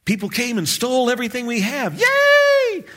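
fast, then a squealed high yay; mock joy. Preacher mocks cheering at a robbery, squealing high. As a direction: rattle it off, then squeal fake delight. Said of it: "mocking forced joy".

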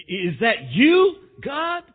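slow question leaping up high; shocked. Acted. Man on a cliff asks who answered him, shocked. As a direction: ask in stunned disbelief, rising sharply. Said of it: "Jack was shocked".

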